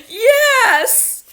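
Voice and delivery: high, loud sobbing yes; bawling. Acted. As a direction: wail it through tears. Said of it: "I bawled".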